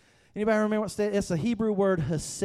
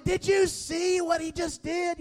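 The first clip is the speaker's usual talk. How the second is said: much higher, thrilled disbelief; excited shout; 12 semitones higher than usual. Retold or imitated. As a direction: shout it to the brother-in-law on the phone. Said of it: "I was like".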